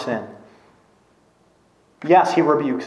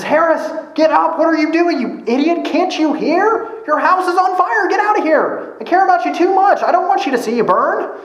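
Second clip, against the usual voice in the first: high, loud pleading yell to wake a sleeper; urgent shout; 13 semitones higher, 3 dB louder than usual. Retold or imitated. Neighbor yelling at sleeping Harris to get out, urgent shout. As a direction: shout him awake from the burning house.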